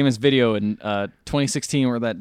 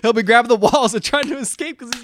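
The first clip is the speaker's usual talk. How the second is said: lightly mimicked worked-up friend, higher voice; mock panic, pitched up; 9 semitones higher than usual. Teasing friend about a bird with hands, mock alarm. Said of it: "You're like".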